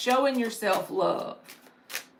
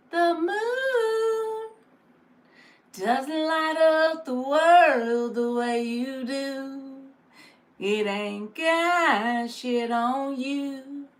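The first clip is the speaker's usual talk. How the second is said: slow, crooned quote with a mock-romantic lilt; quoting Marky; 3 semitones higher, much slower than usual. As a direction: croon it slowly like a corny love line. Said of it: "He said".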